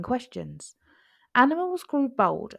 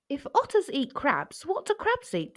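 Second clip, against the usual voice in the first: much higher, rising question; puzzled cub; 8 semitones higher than usual. A narrator's reading. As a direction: climb up in wonder.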